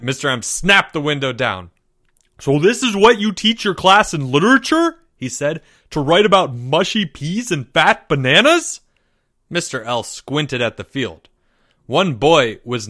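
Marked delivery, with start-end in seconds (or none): 2.4-5.0 high, loud indignant question. A narrator's reading. Mister M confronting Mister L over the poem, indignant. 5.9-8.8 loud, higher incredulous question; indignant. A narrator's reading. Mister M scorning mushy peas and bananas, indignant. Indignant teacher confronts colleague.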